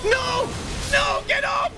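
high furious screaming; raging gamer. Real, not acted. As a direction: scream at the game in rage. Gamer dying in fight.

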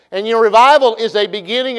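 ordinary talk, nothing marked

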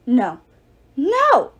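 sharp, rising cry of no; screaming defiance from the net; a trapped goddess shrieking no; furious refusal. Acted. Captured goddess refusing, cursing.

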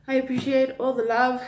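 ordinary delivery

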